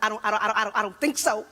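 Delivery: son copying dad's stutter on "I don't"; deadpan mock stutter, stuck on "I don't"; mimicking a stutter. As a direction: repeat "I don't" mockingly before "think so". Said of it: "This is what my son said".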